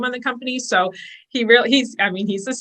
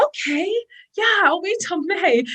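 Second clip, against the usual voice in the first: lightly mimicked, higher, meek agreement; a hint of a passive, waiting voice; meek old self; 10 semitones higher than usual. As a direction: play your old, patient self, going along with it. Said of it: "the old me would have been like".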